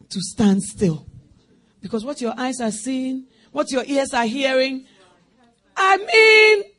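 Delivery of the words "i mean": shouted outburst, high and loud; sudden cry. Acted. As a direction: burst out with a cry. Preacher cries out mid-sermon.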